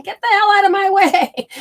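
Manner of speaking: high, loud mock yell at an oncoming train. Overthinker yelling at the train in her head, loud mock yell. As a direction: scream it off the tracks, half-playful. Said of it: "so you yell and scream at that train".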